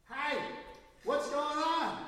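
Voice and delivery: high, loud, carefree hey; loud greeting. Retold or imitated. Newcomer greets the pastor during worship, loud and oblivious.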